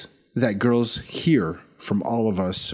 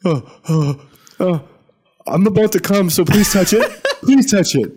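hammed-up moaning, higher and louder; Ronnie's imagined voice; 8 semitones higher, 6 dB louder than usual. Podcaster voicing his friend's Tinder face, mock-aroused pleading. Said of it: "what's your face on Tinder".

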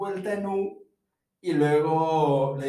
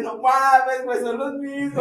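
loud laughing disbelief; 7 semitones higher, 6 dB louder, slower than usual. Retold or imitated. Realizing the campers were themselves, laughing loudly. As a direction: crack up at the realization. Realizing they walked in a circle.

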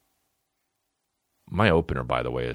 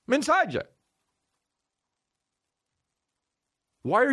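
much higher, strained put-on rant voice; high-pitched mock outrage at a disloyal follower; mock cult leader rant; 12 semitones higher than usual. Rants as cult leader at fan.

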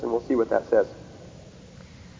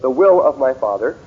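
"will" lifted and louder; louder "The will of my Father" with "will" stressed; 5 dB louder than usual. Shown as a demonstration. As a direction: punch "will" with a rise. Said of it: "I'm emphasizing those specifically".

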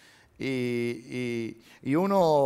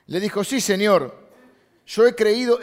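loud, raised Bible reading; emphatic scripture reading; 8 semitones higher, 9 dB louder than usual. Acted. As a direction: proclaim the verse to the congregation. Reading Martha's reply aloud.

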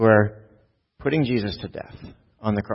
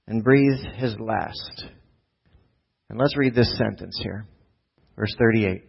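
ordinary talk, nothing marked

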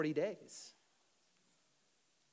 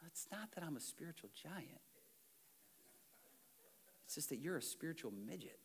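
soft cheeky reply; 3 semitones higher, 11 dB quieter than usual. Retold or imitated.

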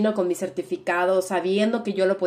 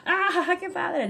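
high, imitating excited Chinese viewers; playful high-pitched imitation of thrilled viewers; mock delight; 9 semitones higher, 3 dB quieter, much slower than usual. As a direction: squeal it like a fan watching a show. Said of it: "es como".

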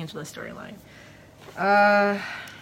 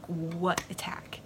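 slow, breathless whisper of confusion; puzzled whisper; 5 dB quieter, much slower than usual. Retold or imitated. Dom whispers to the arrested Tyrell, slow and puzzled. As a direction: whisper it, slowly, not understanding.

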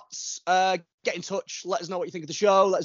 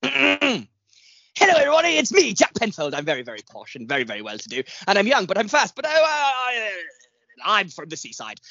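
Jack Penfold; 2 semitones higher than usual. Acted. Podcast host impersonating a runner to introduce the guest, posh impression. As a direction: put on a very posh, well-to-do voice. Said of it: "an impression of Jack Penfold".

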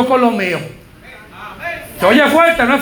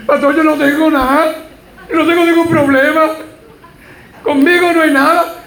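retold tearful woman; 5 semitones higher than usual. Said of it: "Y llorando".

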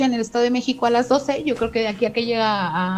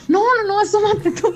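high, louder, panicky no no no; scared refusal; 10 semitones higher, 6 dB louder than usual. Retold or imitated. Father refusing to look outside at the Llorona, high and panicky. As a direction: push the scary job back onto her.

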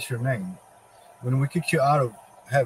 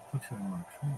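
softer, hushed disbelief; stunned quiet question; 5 semitones lower, 13 dB quieter, faster than usual. Acted. Satan caught off guard, hushed and stunned. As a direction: say it under your breath, taken aback.

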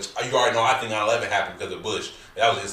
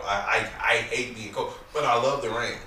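normal talking voice

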